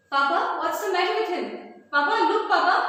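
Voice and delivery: high, loud and quick calls to her father; a startled daughter hurrying her father to look; alarmed daughter. Acted. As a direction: sound the alarm to Papa. Daughter sees Lomov collapse.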